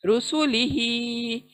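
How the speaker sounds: रुसुलिही with only ही stretched; slow tajweed reading of रुसुलिही; drawn-out recitation. Shown as a demonstration. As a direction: hold ही in रुसुलिही, say the rest quickly. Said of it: "ही को ही खींचना है बस".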